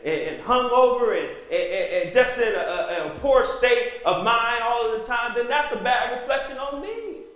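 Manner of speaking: loud, high impassioned preaching. Real, not acted. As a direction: preach it with fire to the congregation. Preacher at full force.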